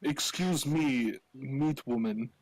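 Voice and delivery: indignant Transylvanian in broken English; comic Transylvanian accent; Vladislav's Transylvanian accent. Acted. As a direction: address the host in a mock Transylvanian accent. Plays Vladislav addressing the meat woman.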